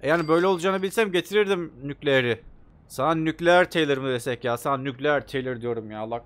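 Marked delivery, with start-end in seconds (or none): none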